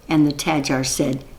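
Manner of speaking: usual speaking voice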